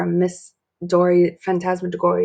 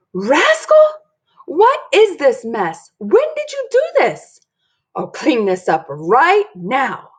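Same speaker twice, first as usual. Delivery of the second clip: higher, louder scolding, slowing down; exasperated mom; 6 semitones higher, 4 dB louder, slower than usual. Acted. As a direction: tell off the child in outrage. Mom finds the giant fort.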